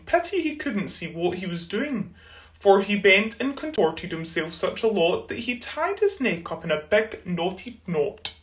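plain reading voice

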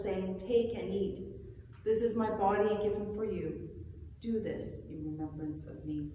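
solemn eucharistic prayer; liturgical recitation. Acted.

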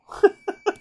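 high burst of laughter. Real, not acted. Laughing at logic bomb.

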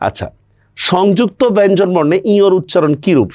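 ordinary talk, nothing marked

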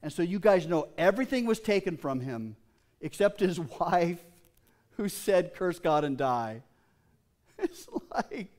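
high, loud, driving sermon voice; preaching at full force. Acted. Preacher telling the congregation about Job, at full force.

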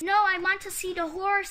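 put-on child digging her heels in; insistent protest. A narrator's reading. Child protests being pulled away.

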